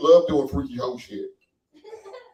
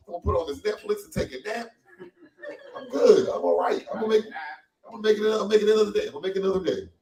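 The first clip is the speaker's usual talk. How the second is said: higher-pitched, giddy turnaround; elated relief; 6 semitones higher than usual. Acted. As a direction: act suddenly thrilled with life. Acting elated post-sex turnaround.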